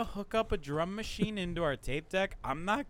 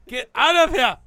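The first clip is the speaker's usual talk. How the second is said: high, loud mock yell; shouting; 10 semitones higher, 14 dB louder than usual. Impostor yelling back at accuser, loud. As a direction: shout him down in play.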